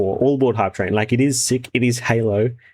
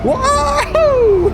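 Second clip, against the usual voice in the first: excited whoa; 6 dB louder than usual. Real, not acted. Excited shout at trailer.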